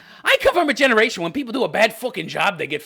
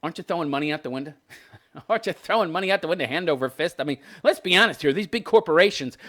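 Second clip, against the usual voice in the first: normal talking voice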